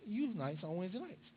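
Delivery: usual speaking voice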